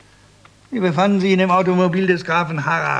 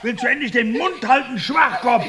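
higher, exasperated telling-off; angry scolding; 7 semitones higher than usual. Acted. Old husband yelling at his wife's sister to stop. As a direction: snap at her to shut up.

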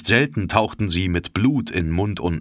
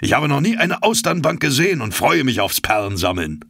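higher, keen and lively; eager harpooner; 8 semitones higher than usual. A narrator's reading. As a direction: sound thrilled about pearl hunting. Ned voiced in read story.

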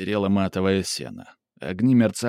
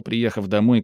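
ordinary delivery, nothing marked

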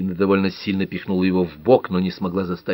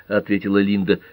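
plain reading voice

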